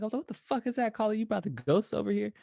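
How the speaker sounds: jumpy at a strange noise in the dark; spooked. Retold or imitated. Hearing noises behind the tent, spooked and high.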